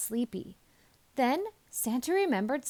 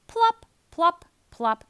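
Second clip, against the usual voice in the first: plopping sounds; 6 semitones higher, 7 dB louder, slower than usual. A narrator's reading. Gumdrops landing sound words.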